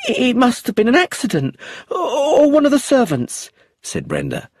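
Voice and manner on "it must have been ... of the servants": high, loud, close to crying; tearful. Acted. Widow grasping for excuses before the inspector, near tears. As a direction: let the voice crack with tears.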